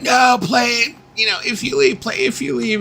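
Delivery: high pleading crowd voice; imitating fans calling for the hit; crowd demanding. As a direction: beg the band to play it. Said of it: "everybody's like".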